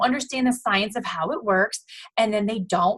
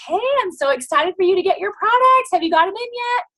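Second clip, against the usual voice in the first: high, bubbly greeting; thrilled coach texting a new customer; excited; 10 semitones higher, 4 dB louder than usual. Pretend. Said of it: "you reach out to them and say".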